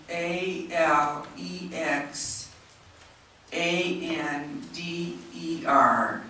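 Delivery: very slow, letter by letter; spelling out slowly. Real, not acted. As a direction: spell it out with long gaps between letters. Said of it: "If I'm talking that slowly".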